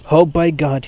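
loud, emphatic exclamation; scornful cry. A narrator's reading. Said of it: "he cried".

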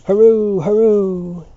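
high, loud double hurrah; cheering cry. A narrator's reading. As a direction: yell it to the sky. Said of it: "and cried again".

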